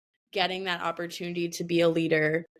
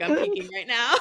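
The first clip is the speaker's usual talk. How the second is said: laughing; 5 semitones higher than usual. Retold or imitated. Laughing at her own quote.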